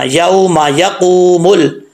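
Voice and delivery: slow यौमा यकूमुल, मा kept short, कू stretched; short meem. Shown as a demonstration. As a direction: pass quickly over मा and hold कू. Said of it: "इस मीम को लंबा नहीं करना".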